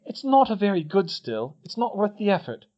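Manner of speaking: put-on high, pointed girl's voice; Hermione, glaring. A narrator's reading. Hermione glares at Fred, high and pointed. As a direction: cut him off with a glare. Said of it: "she glared at Fred".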